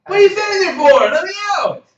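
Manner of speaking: high, loud frantic shouting; scream, terrified and confused; panicked yelling prisoner. Acted. NPC panics in straitjacket.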